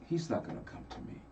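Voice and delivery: hushed, quick, nearly whispered aside; downhearted woman's thought. Retold or imitated. Singer imagines overlooked lady in audience, hushed and downhearted. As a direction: voice her private doubt under the breath. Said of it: "this lady was looking like".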